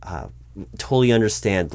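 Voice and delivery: ordinary delivery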